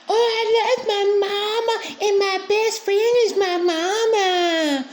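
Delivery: very high, whiny mock little girl voice with a fake sob; mocking childish girl voice. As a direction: whimper it like a clingy little girl, hammed up.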